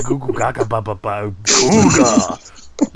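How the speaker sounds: put-on slow babbling baby talk. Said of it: "it sounded like baby talk".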